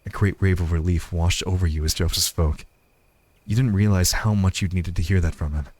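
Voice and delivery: low, even storytelling voice; story narration. A narrator's reading.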